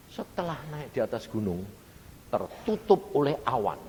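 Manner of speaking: ordinary talk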